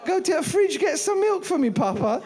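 high, loud mock British toddler; baby ordering her father to fetch milk, in a high, hammed-up British accent; posh British child. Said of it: "my daughter became British".

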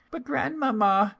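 put-on very high, childlike wonder; amazed little girl. A narrator's reading. Little girl puzzled by grandmother's looks, high childlike wonder. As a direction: marvel as a small child, voice pitched right up.

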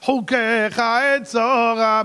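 slow, drawn-out high shout; chanted pleading shout; crying out in prayer. Retold or imitated. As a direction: cry to heaven, stretching each word. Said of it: "אתה עמדת וצעקת".

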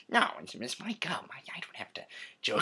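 quiet, whispery protest; Joker role-play. Acted. Joker refusing to give Batman his gum, whispery. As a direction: object softly and hurriedly.

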